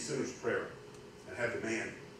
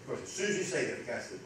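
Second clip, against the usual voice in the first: normal talking voice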